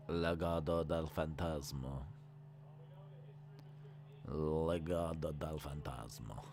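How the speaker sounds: low, soft, lingering "Legado del Fantasma"; savoured Spanish name. Pretend. Streamer savouring a fun name to say, low and soft. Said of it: "kind of fun to say".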